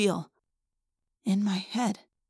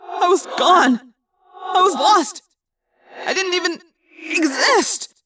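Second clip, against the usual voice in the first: shaken; 11 semitones higher, 6 dB louder, slower than usual. Acted. Shaken after losing himself.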